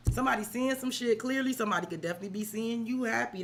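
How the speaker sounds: ordinary talk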